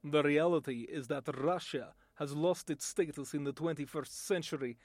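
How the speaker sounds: low, grave Russian accent; a Russian official lecturing on politics. Shown as a demonstration. A Russian statesman addressing the world, in a Russian accent. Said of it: "my voice talent demonstration".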